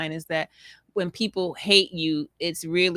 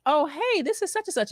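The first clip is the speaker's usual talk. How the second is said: higher, bright caller voice introducing herself; cheery teacher on the phone; 10 semitones higher than usual. Retold or imitated. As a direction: pitch it up like a friendly teacher. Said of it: "the person says".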